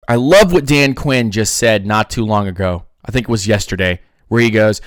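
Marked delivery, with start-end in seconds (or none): none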